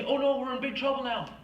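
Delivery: mock panic as the frightened people; panicky people. Scared people crying out there's no hope, in mock panic. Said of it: "they get afraid".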